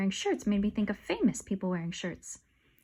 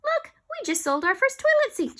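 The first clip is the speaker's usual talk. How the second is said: high, louder excited shout; 14 semitones higher, 5 dB louder than usual. Acted. Alexia shouting at the first sale, high. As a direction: burst out with glee. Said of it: "shouted Alexia".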